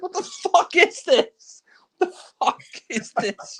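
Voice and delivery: high-pitched incredulous outburst; exasperated disbelief. Real, not acted. Reviewer baffled by the terrible sequence, high exasperated outburst. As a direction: squeal it in baffled outrage. Exasperated reaction to absurd film scene.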